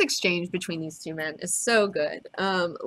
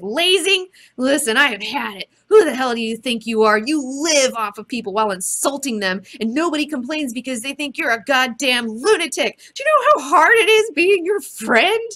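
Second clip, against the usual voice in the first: pissed-off outburst, loud and climbing higher; Night Owl; 6 semitones higher, 7 dB louder than usual. Acted. Night Owl snaps at Rorschach for being hard to befriend, pissed off. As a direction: blow up at your partner, building to a high shout. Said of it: "Night Owl gets pissed".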